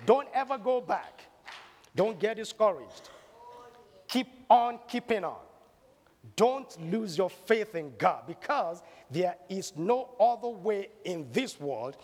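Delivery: preacher urging listeners never to lose faith; rousing preaching. Acted. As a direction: fire up the congregation to keep the faith. Preaching climax.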